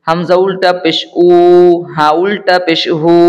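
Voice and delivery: measured chant-like drill with long final vowels; spelling drill recitation. Shown as a demonstration. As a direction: recite each spelling evenly, drawing out the last syllable. Leads a letter-by-letter recitation drill.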